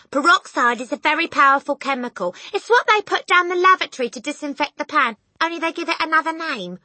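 high, loud explanation; loud lecturing. A narrator's reading. Mother explaining peroxide to husband, loud lecturing. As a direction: warn him at full voice.

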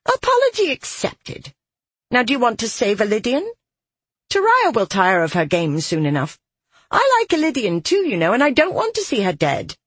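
high, haughty, petulant voice. A narrator's reading. Offended voice in soldier's head, petulant. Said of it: "her anger and petulance".

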